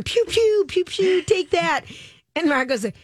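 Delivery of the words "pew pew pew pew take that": replaying the pew pew, higher; mock zap, lightly; imitating laser sounds. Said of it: "Lori, you gotta use them".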